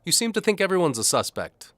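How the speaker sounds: high, loud sneering noble; scoffing nobleman. A narrator's reading. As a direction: sneer at the accuser.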